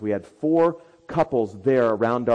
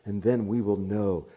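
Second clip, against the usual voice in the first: pastoral prayer; 2 semitones lower, slower than usual. Acted. Closing prayer.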